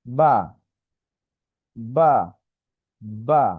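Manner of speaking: বা said with both lips, short and clear; letter demo. Shown as a demonstration. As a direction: model বা from the lips. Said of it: "এটাকে উচ্চারণ করব, যেমন". (দুই ঠোঁট দিয়ে বা উচ্চারণ)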